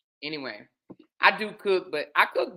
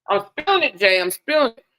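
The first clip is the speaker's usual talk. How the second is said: alarmed; 4 semitones higher, 6 dB louder than usual. Real, not acted. Spilling food, alarmed.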